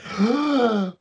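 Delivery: high, sharp mock gasp; shocked gasp. Son discovering the guns are gone, shocked gasp. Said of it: "this shocking moment of".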